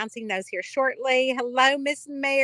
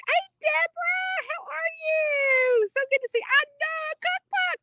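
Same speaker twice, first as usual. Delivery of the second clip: excited greeting. Real, not acted. Greeting viewer Deborah on livestream.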